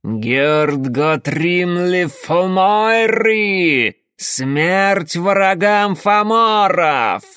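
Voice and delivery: very high, loud, slow shrieked cry; shrill screeching bird woman. Acted. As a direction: screech it like a war-crazed harpy. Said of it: "оно пронзительно заверещало".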